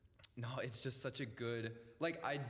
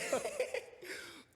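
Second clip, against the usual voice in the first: high wheezing laugh; breathless laugh. Real, not acted. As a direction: laugh at the complaint. Laughing at friend's complaint.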